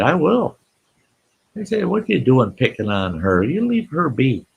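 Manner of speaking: high-pitched indignant telling-off; mock-indignant young defender; retold scolding. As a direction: stand up to a bully, voice rising high. Boy scolds bully in retold quarrel.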